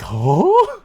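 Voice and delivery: mock shocked huh; baffled huh. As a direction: squeak it out in disbelief.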